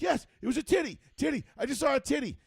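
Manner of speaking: high, loud, thrilled insistence; excited yelling. Retold or imitated. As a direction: shout it like a giddy teenager. Kids shouting in retold story.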